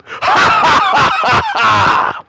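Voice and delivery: high shrieking burst of laughter; a real shrill laugh breaking out. Real, not acted. Cackling at the punchline.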